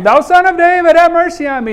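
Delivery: high, loud pleading cry; crying out for mercy. Retold or imitated. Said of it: "He's crying out and getting kinda loud".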